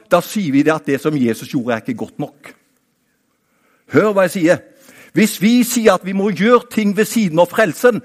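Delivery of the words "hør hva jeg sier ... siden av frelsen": higher, louder preaching call; raised preaching voice. Real, not acted. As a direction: lift the voice to make them hear. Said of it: "nå vil jeg ikke rope da".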